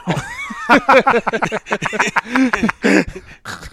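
high, loud laughing fit; real laugh at own joke; long laugh. Real, not acted. Host laughs at his own jab at Chicago, long laugh.

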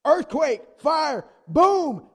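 very loud, high bellow; explosive, thunderous exclamations; booming shout. Acted. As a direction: shout each word like a blast. Booms disasters against the whisper.

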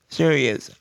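ordinary talk, nothing marked

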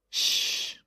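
long soft "shh"; quiet drawn-out shushing. A narrator's reading. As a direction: hush a child at bedtime. Mother hushes child at bedtime.

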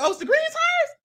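very high surprised squeal; mock realization. Recapping the show, high mock realization. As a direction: pitch it way up as it dawns on him. Contestant realizes tires' color.

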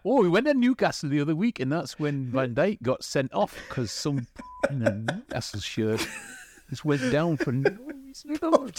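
put-on quick, high Geordie accent. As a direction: rattle it off in broad Geordie. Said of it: "hear your take on a Geordie accent".

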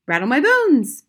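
high, loud spooky parrot. A narrator's reading. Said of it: "wonderful imitation of a spooky voice".

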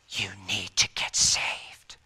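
slow, low whisper; hushed, drawn-out whispered urging; whispered plea. Retold or imitated. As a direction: whisper it slowly and gravely. Retold plea, dropped to whisper.

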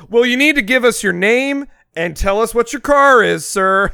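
hammed-up impatient clerk snapping back; mock rude clerk. Imitating rude receptionist.

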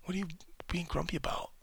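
mock partner gently chiding; Nicole teasing. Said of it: "And Nicole's like".

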